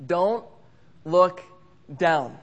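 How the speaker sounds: big pause after each word. Retold or imitated. Crazy guy giving one thing to remember, word by word. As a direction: deliver it as a weighty, spaced-out warning. Friend's solemn advice, word by word.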